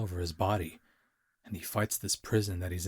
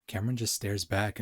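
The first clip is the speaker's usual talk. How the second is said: ordinary delivery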